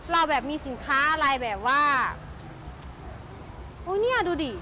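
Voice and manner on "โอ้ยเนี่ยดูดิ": ordinary talk, nothing marked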